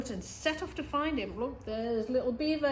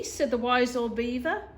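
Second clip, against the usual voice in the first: ordinary delivery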